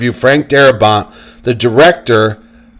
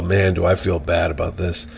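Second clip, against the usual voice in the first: regretful; 8 semitones lower, 11 dB quieter than usual. Retold or imitated. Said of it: "I'm like".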